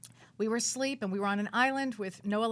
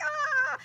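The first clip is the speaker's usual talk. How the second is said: mock frightened shriek; 6 dB louder than usual. Retold scream of fright.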